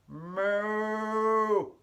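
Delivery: high, loud, stretched-out cow moo. Acted. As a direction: give a big, long playful moo. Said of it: "and the cow says".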